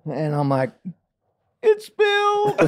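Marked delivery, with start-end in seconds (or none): 1.6-2.6 put-on very high tearful kid wail; mock crying. Boy confessing the spill to dad, mock crying. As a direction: wail it like a blubbering child, hammed up. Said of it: "I'm crying".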